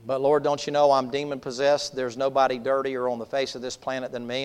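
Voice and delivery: low, soft confession; lightly voiced ashamed man. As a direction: confess unworthiness quietly to the Lord. Possessed man confesses to Jesus.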